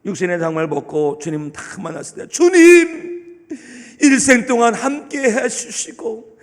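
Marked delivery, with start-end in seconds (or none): 2.3-6.4 heartfelt grateful prayer. Pretend. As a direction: pour out gratitude on first meeting the Lord. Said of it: "이런 역사가 있어야 되는 거예요".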